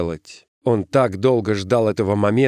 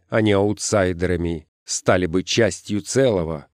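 ordinary delivery

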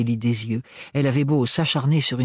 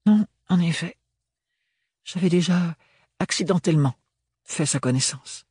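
higher voice in halting bits; uneasy, higher-pitched character voice; Ophélie, hesitant; 6 semitones higher than usual. A narrator's reading. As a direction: admit it reluctantly, with pauses.

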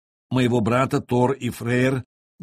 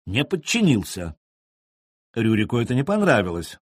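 usual speaking voice

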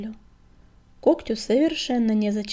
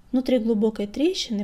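plain reading voice

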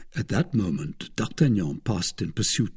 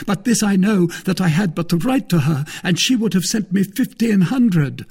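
a wounded noble's raised complaint; Porthos voiced high and loud; 9 semitones higher, 5 dB louder than usual. A narrator's reading. Porthos reproaching the wife, loud and high. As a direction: lay the guilt on thick, pitched high.

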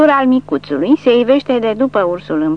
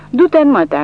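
a touch of hateful defiance, slightly raised; hateful retort; 6 semitones higher, 5 dB louder than usual. A narrator's reading. Captive Barcelona cursing his Russian interrogator, mildly hateful. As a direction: spit it at the officer with contempt. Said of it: "privindu-l fix pe ofițeraș cu ochi plini de ură".

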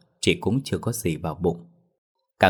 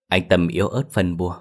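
usual speaking voice

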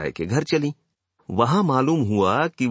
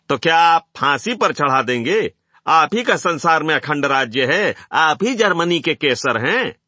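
high, raised sarcastic questions; mocking heckle; 5 semitones higher, 4 dB louder than usual. A narrator's reading. Crowd to the speaker on stage, loud scornful jeering. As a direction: jeer back at his authority.

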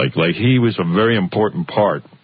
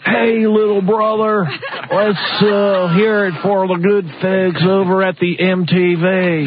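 slow, high mocking Southern twang; deep Southern drawl; 8 semitones higher, slower than usual. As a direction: drag it out like a hammed-up Southern good ol' boy. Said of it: "deep Southern drawl".

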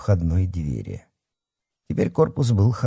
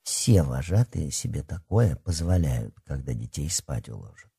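knowing; 5 semitones lower, 5 dB quieter than usual. Acted. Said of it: "сознанием дела проговорила".

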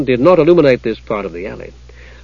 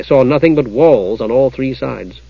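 usual speaking voice

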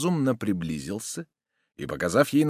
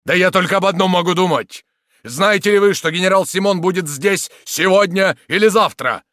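high, loud, agitated explanation; desperate outburst; 9 semitones higher, 9 dB louder than usual. A narrator's reading. Soldier explaining that the general is coming, desperate. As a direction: pour it out in desperation.

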